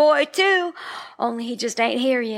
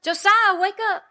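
high call to wake him; 8 semitones higher than usual. Acted. Waking sleeping husband in car.

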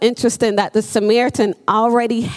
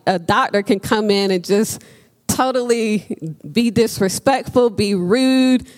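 ordinary talk

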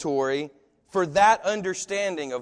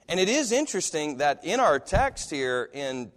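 ordinary talk

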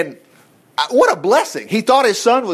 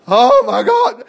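mock alarm; 6 semitones higher, 5 dB louder, slower than usual. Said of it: "they're not going".

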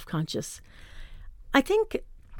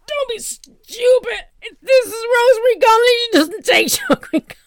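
much higher, loud, tight snap, a laugh breaking through; mock-indignant squeak at friend; strained scolding; 8 dB louder than usual. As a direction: scold her friend through held-in breath. Snapping at friend while stomach in.

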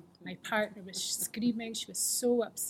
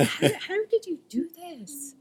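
amazed asking; 10 semitones higher, 6 dB louder than usual. Retold or imitated. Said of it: "and I said".

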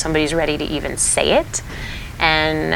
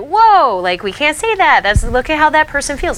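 high, loud startled "whoa"; shocked teacher; 10 semitones higher, 6 dB louder than usual. Retold or imitated. Teacher reacting to a child's rude remark, high and startled. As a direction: pull the child up short, alarmed. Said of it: "at first you're like".

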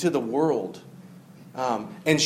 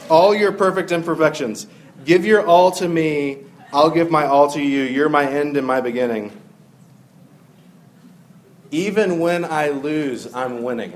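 reciting song lyrics; 5 dB louder than usual. Acted. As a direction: declaim the love song lyrics with a wink. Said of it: "I know it sounds cheesy".

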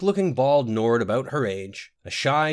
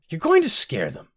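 high, louder reproach; admonishing; 7 semitones higher, 5 dB louder than usual. A narrator's reading. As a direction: scold him for his tactlessness. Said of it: "Rosalind admonished him".

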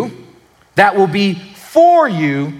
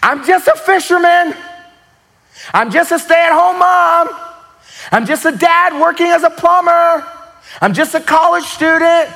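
surrendering prayer; 11 semitones higher than usual. Retold or imitated. Believer surrendering to Jesus, high pleading cry. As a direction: pour it out as a raw surrender. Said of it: "You gotta be willing to say".

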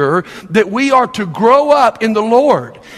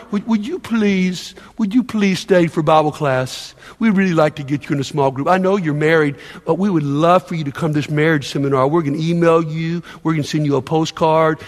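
put-on softer, pleading coax like to a baby; mock coddling plea; 5 semitones lower, 3 dB quieter than usual. Preacher mocking how church begs members, soft pleading coax. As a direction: lightly sweet-talk a reluctant member. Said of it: "And we talk to them like they're".

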